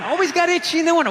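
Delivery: rattled off fast, exasperated; fast repeat. Shown as a demonstration. Comic repeating his winter itch complaint, fast. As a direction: rush through the repeated complaint. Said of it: "repeats the complaint".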